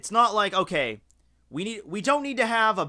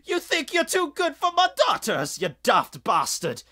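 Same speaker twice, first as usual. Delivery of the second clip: high, loud, outraged Scottish bark; hammed-up furious Scots father; mock angry Scotsman; 10 semitones higher, 4 dB louder than usual. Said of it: "He's just like".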